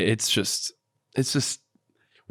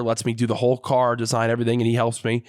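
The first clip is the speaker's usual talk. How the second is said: ordinary delivery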